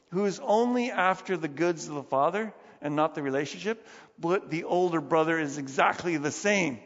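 ordinary talk

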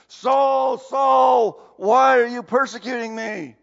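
loud, commanding call from above; booming heavenly voice. Retold or imitated. Voice from heaven calling Saul on the Damascus road, loud. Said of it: "a voice calls from heavens".

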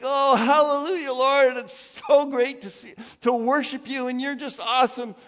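loud, high mock-rapturous worship; hammed-up hallelujah fervour; gushing praise. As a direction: act out an over-the-top worshipper praising aloud.

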